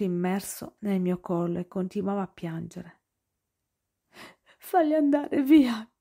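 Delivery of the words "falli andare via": high, slow, begging through tears; sobbing plea. A narrator's reading. Noah begging Nick through sobs, tearful plea. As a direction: plead with him, crying. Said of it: "mi supplicò tra i singhiozzi".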